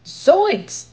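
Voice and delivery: high, loud shocked exclamation; startled yelp. Acted. Shaggy spotting a scary face in the field, a high startled yelp. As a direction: jump at the sight.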